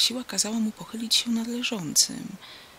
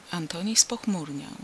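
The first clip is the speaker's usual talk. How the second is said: ordinary delivery, nothing marked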